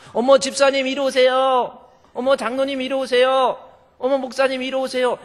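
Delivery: high, loud, delighted greeting; sweet church-lady welcome; gushing welcome. Acted. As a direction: gush over each guest arriving. Warm welcoming greeting acted.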